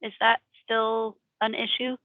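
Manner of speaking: ordinary delivery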